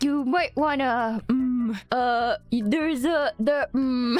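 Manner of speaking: imitating a friend stalling, slow and halting; hesitant bad news. Friend at the car window reporting damage, hesitant. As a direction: reluctantly hedge with hums and false starts.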